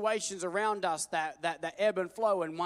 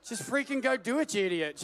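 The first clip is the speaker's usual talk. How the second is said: exasperated; 6 semitones higher, 6 dB louder than usual. Acted. Mock exasperation at Moses.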